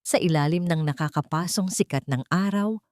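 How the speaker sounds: ordinary delivery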